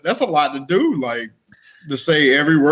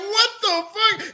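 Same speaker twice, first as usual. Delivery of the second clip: high, slow, broken-voiced disbelief; mock horrified gasp of a quote; shocked outburst; 5 dB quieter, slower than usual. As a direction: squeal it out, half crying with laughter. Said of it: "and she was like".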